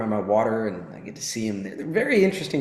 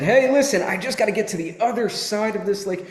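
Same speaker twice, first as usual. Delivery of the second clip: higher, lively plea to a stranger; lightly voiced scorpion, pitched up and urgent; eager scorpion; 8 semitones higher than usual. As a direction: call for attention, then make your request. Scorpion voiced pleading with frog.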